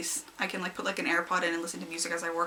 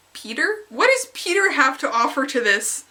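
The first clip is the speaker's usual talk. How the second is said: incredulous; 5 semitones higher, 5 dB louder than usual. Retold or imitated. Retold reaction to Peter.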